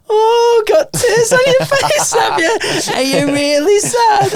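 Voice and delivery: very high, loud mock-pitying voice; jovial teasing. MC teasing a tattooed bloke, high jovial mockery. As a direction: tease with slow, hammed-up baby sympathy. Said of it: "I was in one of my jovial moods".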